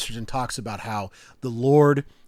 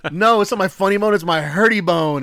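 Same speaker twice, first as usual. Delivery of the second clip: much higher, louder silly dad voice; playful dad; 8 semitones higher, 8 dB louder than usual. Retold or imitated. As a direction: ham it up for a laughing child. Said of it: "And then you go".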